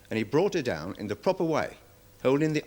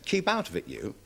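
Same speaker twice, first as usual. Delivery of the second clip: mimicking the boy's retort, much higher; rude boy; 8 semitones higher than usual. Handler mimicking the boy telling him to butt out. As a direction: toss it back like a cheeky lad. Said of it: "He said".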